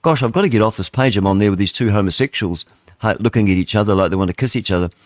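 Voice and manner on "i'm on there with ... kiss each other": usual speaking voice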